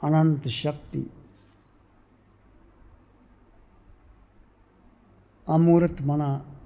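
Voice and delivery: measured meditation voice with long pauses, softening as it goes; guided meditation. Real, not acted. Teacher leading seated devotees into inner silence, guided meditation. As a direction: guide listeners inward, phrase by phrase, letting endings fade.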